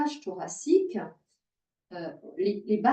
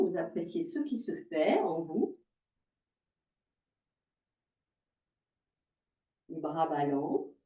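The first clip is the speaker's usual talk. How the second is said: low, slowing, softening guiding voice; calm yoga guidance; 5 semitones lower, 3 dB quieter than usual. Real, not acted. Yoga teacher guiding students to notice their breath, calm. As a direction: settle them gently into stillness, leaving pauses. Guides students into standing awareness.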